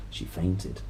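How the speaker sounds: ordinary delivery, nothing marked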